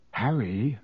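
ordinary delivery

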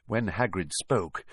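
ordinary delivery

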